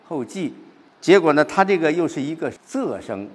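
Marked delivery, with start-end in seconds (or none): 0.1-0.5 “骑” in “后骑” said jì, falling; noun reading jì. Shown as a demonstration. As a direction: say “后骑” as hòu jì. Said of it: "肯定是读". (“后骑”的“骑”读 jì)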